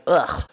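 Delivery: loud groan of distaste; disgusted ugh. Retold or imitated. Best friend reacting to the nasty boyfriend, loud disgusted groan.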